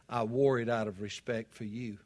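low, soft and calm; very calmly. Retold or imitated. Friend calmly answers the buddy who mocked his tie, low and soft. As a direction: answer quietly, without any bite. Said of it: "looked at him very calmly and said".

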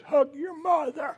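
over-enunciate the command like a stern father; dad's silent order. Retold or imitated. Father in recliner ordering his son, mock mouthed yell. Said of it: "I read his lips".

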